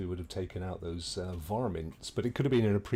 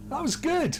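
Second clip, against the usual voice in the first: much higher laughing delight; 4 dB louder than usual. Real, not acted. Gamer cracks up after dying on stream. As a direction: laugh it out loud.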